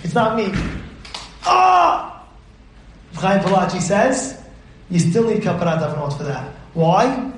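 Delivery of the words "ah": high, loud "Ah!"; one long high cry of shock. Acted. Acted shock at fake burger news.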